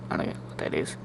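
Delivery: breathy throwaway whisper under the breath; whispered aside. Real, not acted. Muttered aside to audience.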